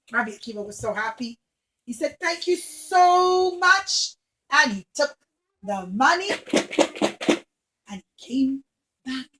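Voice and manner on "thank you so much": slow, louder, higher, beaming; overjoyed thanks. Acted. Rabbi Akiva thanking the lady, overjoyed. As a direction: draw out grateful joy.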